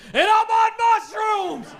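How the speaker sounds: hammed-up surgeon yell; mock excited shout. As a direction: burst out proudly high. Surgeon blurting he's tripping.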